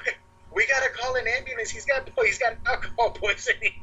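high-pitched mock panic; panicked girlfriend. Girlfriend panicking over alcohol poisoning.

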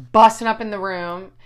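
ordinary talk

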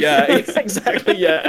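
high, excited laughing yes. Real, not acted. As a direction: giggle out the agreement. Laughing agreement.

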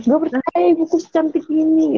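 high-pitched mock disbelief; Sangkuriang.

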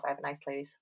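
ordinary talk

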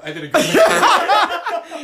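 slow, high, laughing impression; hammed-up pleased lady, drawn out; self-satisfied lady. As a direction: crow it slowly, loud and high. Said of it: "she was very satisfied".

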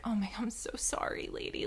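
unvoiced whisper, half to herself; whispered apology. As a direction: breathe the apology under your breath. Apologetic aside to woman in car.

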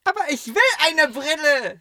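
put-on high whining kid voice, pleading; whiny child. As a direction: whine it like a stubborn little boy. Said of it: "ich war richtig am Quengeln".

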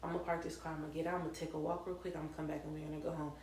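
quick, quiet, matter-of-fact quote; retold calm brother. Brother telling his drunk girlfriend he'll walk, calm retold voice. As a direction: reel it off softly and fast. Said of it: "he was like".

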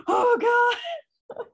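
high gasped cry of dismay; gasp, then let it out high; aghast reaction. Retold or imitated. Reader reliving Delano and Reaver going down, aghast. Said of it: "I was just like".

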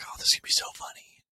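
a quick, breathless, scheming whisper; fast gleeful whisper. Retold or imitated. Host recalling her prank, a fast sneaky whisper. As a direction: whisper it fast, like you can't wait for the prank.

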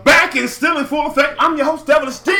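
shouted hype announcer intro; radio host intro. Acted. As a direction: hype up the show opener, loud and high.

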